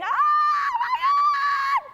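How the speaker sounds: long, high full-force scream. Retold or imitated. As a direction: scream it drawn out in comic horror. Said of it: "So I'm screaming".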